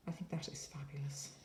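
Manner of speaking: hushed, breathy admiration; whispering. Real, not acted. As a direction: whisper it in awe. Admiring the craft kit.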